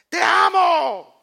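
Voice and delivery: very high, loud mock shout; hammed-up yelling of love; screaming I love you at the family. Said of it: "¿A gritos?".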